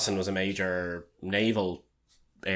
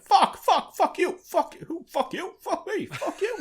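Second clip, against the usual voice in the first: a squeaky, much higher Boston swearing rant; bad Boston accent; slower than usual. Imitating the Departed swearing in a squeaky Boston accent. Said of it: "that was a worse Boston accent".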